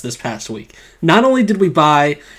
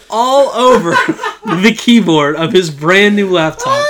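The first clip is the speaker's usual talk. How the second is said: laughing; 5 semitones higher than usual. Real, not acted. Laughing at near spill.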